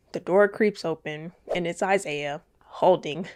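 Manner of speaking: usual speaking voice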